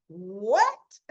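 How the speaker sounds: loud, drawn-out shocked question; exaggerated astonished reaction; mock shock. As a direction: burst out in playful disbelief. Her own shocked thought, retold.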